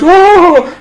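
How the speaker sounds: high, swelling wail; hammed-up high cry of horror; Judy's shocked cry. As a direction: cry out as if about to faint. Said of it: "And Judy goes".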